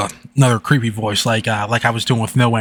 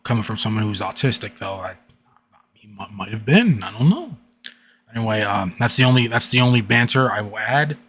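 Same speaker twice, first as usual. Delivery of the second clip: ordinary delivery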